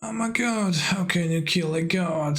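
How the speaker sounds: high shriek sliding down to dramatic lament; shrieking jackpot winner. Acted. Quoted line performed over the top.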